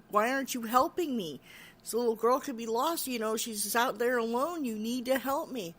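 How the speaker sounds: exasperated retold appeal; urgent demand. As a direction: press them insistently for help. Frustrated woman demands help.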